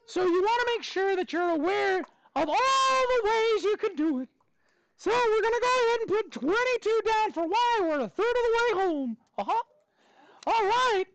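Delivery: high, squeaky cartoon mouse voice held through the whole lesson; Mickey Mouse voice. Teacher quizzing his class on equations in a high Mickey Mouse voice. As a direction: teach the math steps as a cheerful cartoon mouse. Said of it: "I gotta do the voice too".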